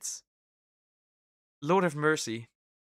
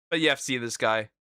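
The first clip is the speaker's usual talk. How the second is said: ordinary delivery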